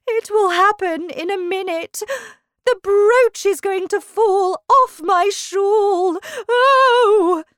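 high, loud Queen bracing for pain; agitated Queen. Acted. Queen foresees her brooch falling off, agitated and high. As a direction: wail it in alarm as the Queen.

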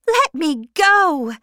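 loud, high furious demand; angry cry. Acted. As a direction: shout to be released. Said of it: "she cried".

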